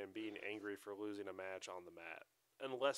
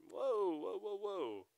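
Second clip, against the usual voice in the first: alarmed whoa; 10 semitones higher, much slower than usual. Retold or imitated. Cutting off rival coach.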